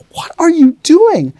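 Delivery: high, loud, drawn-out disbelief; mock appalled outcry. Bystanders watching a teacher beat his student, acted appalled. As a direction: cry out like a horrified onlooker.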